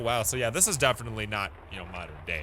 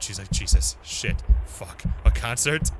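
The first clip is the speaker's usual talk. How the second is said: ordinary delivery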